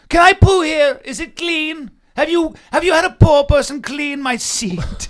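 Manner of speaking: prissy tycoon sneering at the poor; Mister Burns. Pretend. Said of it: "You're like Mister Burns".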